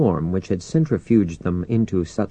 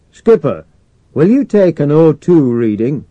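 loud, higher-pitched radio hail; raised voice, calling over the radio; 7 semitones higher, 8 dB louder than usual. A narrator's reading. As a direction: call up to the skipper over comms. Calling hub control over radio.

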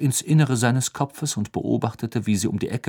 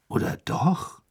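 skeptical; 9 semitones higher than usual. A narrator's reading. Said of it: "wiederholte Ron mit skeptischer Miene".

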